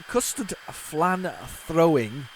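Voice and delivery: slowed right down, picking each word out carefully; deliberately careful, syllable by syllable; slow careful tongue-twister. Real, not acted. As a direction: ease through the tricky words one at a time. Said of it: "that's easy for me to say".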